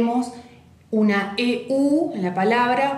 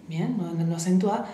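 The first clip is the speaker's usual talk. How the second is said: ordinary talk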